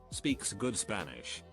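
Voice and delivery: ordinary delivery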